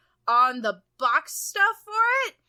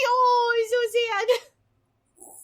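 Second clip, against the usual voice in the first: squealing aww; 4 dB louder, slower than usual. Real, not acted. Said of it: "became squealing".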